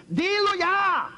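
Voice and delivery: high, slow, loud command; God's booming final order; commanding shout. Acted. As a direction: order it firmly, drawn out. God commands him to speak now.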